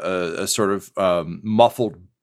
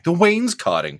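dramatic exclamation; 12 semitones higher than usual. Pretend. GM mourns destroyed wainscoting.

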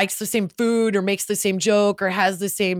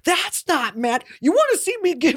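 mock-mad parent; 7 semitones higher than usual. Mother answering her son's complaint, mock mad. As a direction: act out a threat, stretching the words. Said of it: "you're so mad all the time".

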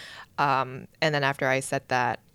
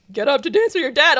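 MC call; 10 semitones higher, 8 dB louder than usual. Retold or imitated. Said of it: "the M C was like".

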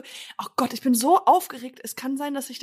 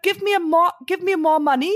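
lightly acted robber demand, higher and louder; retold English robbery demand; 4 semitones higher, 3 dB louder than usual. Robber retelling the hold-up to partner, disguised in English. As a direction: re-enact a hold-up in German-accented English. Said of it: "Ich hab's auf Englisch gemacht".

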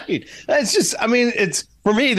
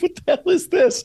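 high, mock-baffled squawk; baffled director. Director reacting to a Scottish-accent take, high baffled squawk. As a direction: squeak it out in disbelief.

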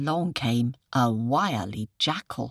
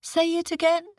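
high, dopey questioning jackal; puzzled jackal; 14 semitones higher, 4 dB louder than usual. Acted. Jackal asks again.

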